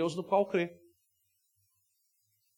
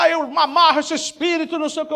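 mock shouted binding; 7 semitones higher, 7 dB louder than usual. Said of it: "Ele fala".

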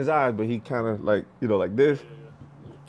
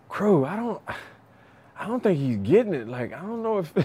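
higher-pitched, hesitant worried quote; worried, frustrated dad; 8 semitones higher than usual. Retold or imitated. Worried parent confiding in the coach, frustrated. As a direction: imitate an anxious parent, voice raised in pitch. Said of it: "he was so worried and frustrated".

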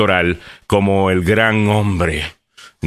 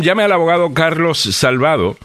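ad read; 8 semitones higher than usual. Acted. Ad read for sponsor.

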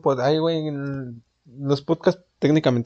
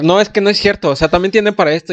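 louder, higher, fast indignant comeback; mock indignant retort; 7 semitones higher, 7 dB louder, faster than usual. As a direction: snap back defensively, hammed up. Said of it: "luego va a atacar".

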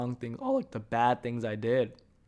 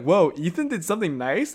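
mock surprise; 5 semitones higher, 7 dB louder than usual. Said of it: "They're like".

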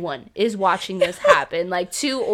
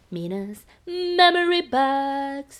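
slow, drawn-out announcer jingle; sing-song it like a segment title; playful segment-title voice; 3 semitones higher, much slower than usual. Pretend. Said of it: "we should have to say it like that".

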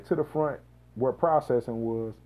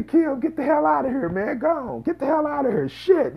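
higher, louder half-laughing shoo; mock shooing; 13 semitones higher, 6 dB louder than usual. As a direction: brush her off with a laughing yell. He shoos the cousin away.

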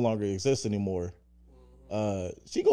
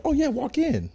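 high mock politeness. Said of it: "be like".

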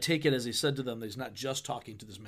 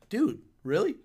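exasperated paraphrase; 4 semitones higher than usual. Retold or imitated.

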